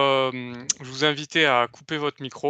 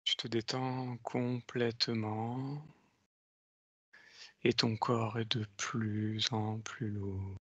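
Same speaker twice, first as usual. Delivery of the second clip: slow, soft hypnotic countdown; slow hypnotist voice; 3 semitones lower, 6 dB quieter, much slower than usual. Acted. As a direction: lull her deeper, slowly. Leads a hypnotic induction. (voix lente d'hypnotiseur)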